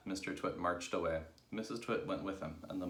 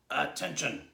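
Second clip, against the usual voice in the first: loud, raised monkey trainer command; fearsome trainer's bark; 8 semitones higher, 6 dB louder than usual. A narrator's reading. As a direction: bark it like a drill order. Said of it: "barked in his fearsome monkey trainer's voice".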